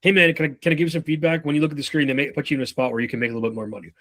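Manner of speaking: fast, light run-through; quick breezy feedback. Shown as a demonstration. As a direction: toss it off quickly and move on. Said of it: "here's how the feedback always sounds".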